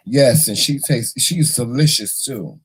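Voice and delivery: usual speaking voice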